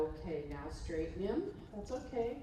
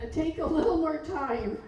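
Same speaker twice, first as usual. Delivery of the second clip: mimicking her own command; 7 semitones higher, 9 dB louder than usual. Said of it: "It's like he does it when I say".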